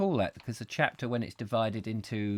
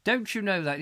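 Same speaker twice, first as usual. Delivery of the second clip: mocking father; 9 semitones higher than usual. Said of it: "It's like".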